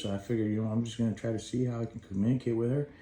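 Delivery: ordinary delivery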